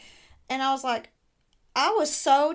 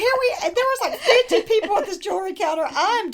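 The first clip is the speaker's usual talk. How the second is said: laughing; 9 semitones higher, 6 dB louder than usual. Real, not acted. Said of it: "laughing while telling".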